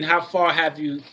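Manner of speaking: normal talking voice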